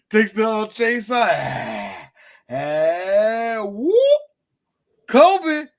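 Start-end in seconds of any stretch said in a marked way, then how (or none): 2.5-5.7 loud yell. Retold or imitated. Reenacting chainsaw scene.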